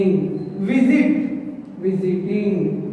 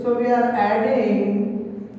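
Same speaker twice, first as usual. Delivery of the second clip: ordinary talk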